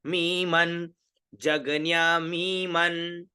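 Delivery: जगन्या मी मन chanted slowly on a level note; pronunciation drill. Shown as a demonstration. Teacher drills the words for students, slow level मी मन. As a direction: stretch each long vowel in जगन्या मी मन. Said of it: "उच्चारण क्या हुआ".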